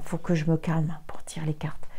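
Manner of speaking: whispered to herself before drawing cards; hushed, breathy aside. Real, not acted. Tarot reader whispering to herself while shuffling, hushed.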